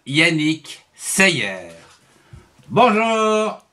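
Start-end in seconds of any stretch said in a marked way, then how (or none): 2.7-3.7 lightly higher puppet bear greeting; a friendly cartoon bear says hello. Bear puppet greets the children, lightly higher. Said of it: "je suis l'ours Syllabe".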